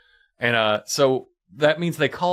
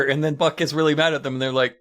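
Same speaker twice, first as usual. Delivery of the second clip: usual speaking voice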